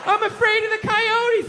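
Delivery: wife making a flimsy excuse, mock-timid; imitating wife's excuse. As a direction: play the wife pleading, wide-eyed. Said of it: "She goes".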